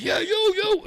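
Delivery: high mock yell; shout. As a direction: call him over with a yelp. Manager shouting to him.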